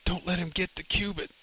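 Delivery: high, weak moaned plea; moaning. A narrator's reading. Wounded professor pleading with Lenny, high moaned plea. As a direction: moan it out as if badly wounded. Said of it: "the professor moaned".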